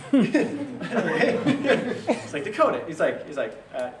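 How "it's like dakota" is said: laughing while quoting. Real, not acted.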